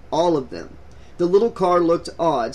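plain reading voice

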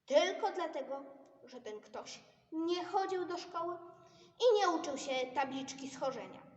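loud, high indignant outburst. Acted. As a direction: complain loudly, outraged.

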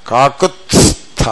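కాకుత్స్థ drawn out, t, s, th distinct; correct t-s-th. Shown as a demonstration. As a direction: slowly model కాకుత్స్థ as kaa-kut-s-tha. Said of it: "అని పలకాలి".

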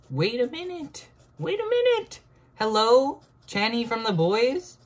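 very high, loud shrieking; excited shriek. Real, not acted. Amazed by her drawing, very high shriek. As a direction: squeal in disbelief.

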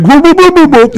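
very high mock whoops; silly whooping. Imitating another prequel character, very high mock whooping. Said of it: "the other guy's like".

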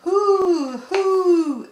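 long storybook owl calls; owl hoot. A narrator's reading. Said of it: "asked the owl".

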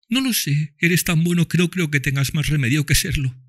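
put-on high child voice, tender; dying girl speaks lovingly to Tom and Mami, high child voice; loving little girl. A narrator's reading. Eva answers.

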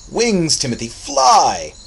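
loud, high rallying cry; exuberant shout. A narrator's reading. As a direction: urge the boy into flight at full voice. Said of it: "shouted Einar".

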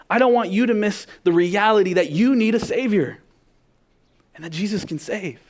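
fiery preacher; full-force preaching. Acted. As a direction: preach it out, building to a climax.

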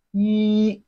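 "yi" held long on one level high pitch; flat tone. Shown as a demonstration. Teacher models Chinese one for learners, flat-tone "yi". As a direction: sustain "yi" without any bend. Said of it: "It has a flat tone".

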